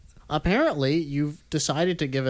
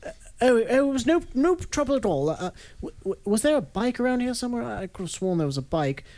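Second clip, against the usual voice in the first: British politeness; 7 semitones higher than usual. Retold or imitated. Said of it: "British people are super polite".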